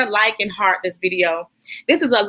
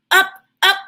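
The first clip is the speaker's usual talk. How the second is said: higher and louder on each up; rising up; 9 semitones higher, 4 dB louder than usual. Acted. Narrator following kids springing off the board, voice rising. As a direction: lift the voice as they spring up.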